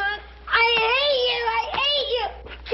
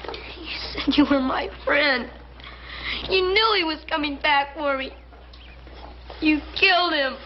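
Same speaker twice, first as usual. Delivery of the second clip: tearful. Acted. Boy accuses man of killing.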